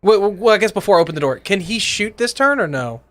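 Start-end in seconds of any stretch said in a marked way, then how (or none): none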